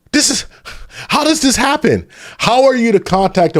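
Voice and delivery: can't believe what he's reading; stunned disbelief. Real, not acted. Streamer reacting to the fraud listing, stunned high disbelief. Shocked by forty child care contacts.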